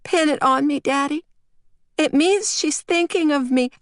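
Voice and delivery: put-on very high small-child voice; little girl. A narrator's reading. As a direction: ask Daddy like an earnest preschooler. Small girl asks about wings.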